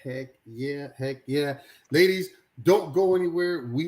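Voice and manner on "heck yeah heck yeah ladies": usual speaking voice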